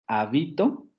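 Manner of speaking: «habito» con el golpe en BI; acento en la sílaba media. Shown as a demonstration. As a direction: marca la palabra como llana: ha-BI-to. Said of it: "con el acento en bi". («habito», acentuando la penúltima sílaba)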